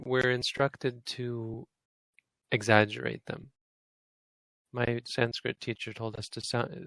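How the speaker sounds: normal talking voice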